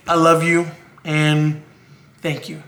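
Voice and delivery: husband speaking softly to his wife who will edit the video; tender thanks. Real, not acted. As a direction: let it fall soft and sincere, trailing off.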